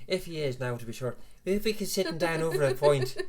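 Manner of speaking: a bad Southern Irish accent, laid on thick. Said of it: "That was the worst Southern Irish accent ever".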